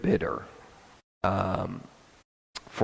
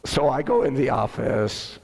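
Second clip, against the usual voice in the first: ordinary talk, nothing marked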